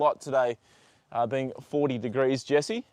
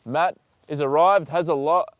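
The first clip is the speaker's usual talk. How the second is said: ordinary talk, nothing marked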